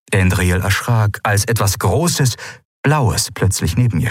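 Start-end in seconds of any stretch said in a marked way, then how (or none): none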